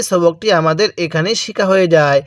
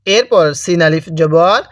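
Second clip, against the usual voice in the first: ordinary talk, nothing marked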